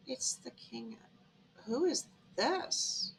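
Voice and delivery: very soft, awed murmur; awestruck hush. Acted. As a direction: breathe it out softly, as if starstruck. Acted awe on seeing the guest.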